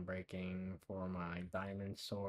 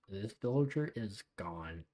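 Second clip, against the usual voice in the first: normal talking voice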